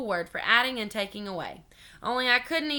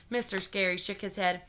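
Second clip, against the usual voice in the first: usual speaking voice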